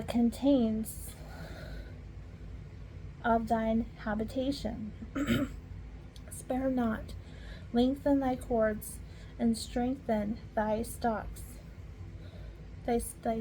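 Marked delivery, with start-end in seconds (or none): none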